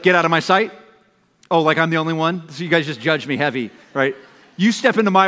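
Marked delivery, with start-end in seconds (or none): 0.0-0.7 frustrated parent. Retold or imitated. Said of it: "frustrated with a kid and you're like".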